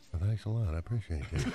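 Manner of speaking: very low, soft cowboy mumble; hushed deep rodeo cowboy; bull rider's talk. Shown as a demonstration. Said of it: "That's the way they talk".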